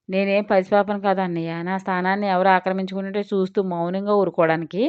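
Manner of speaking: plain reading voice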